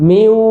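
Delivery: "মিউ" held with a nasal glide; ghunnah hold. Shown as a demonstration. Teacher shows the merge, "মিউ" sustained through the nose. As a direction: hum on the end of "মিউ". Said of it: "গুন্না হয়ে গেল".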